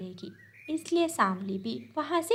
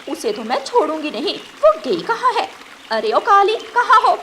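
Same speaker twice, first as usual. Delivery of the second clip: high, louder yelling, building to a scream; angry shouting; 9 semitones higher, 6 dB louder than usual. Acted. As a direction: hunt for the hiding little bird. Mother crow hunts little bird.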